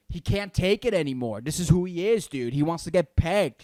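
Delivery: normal talking voice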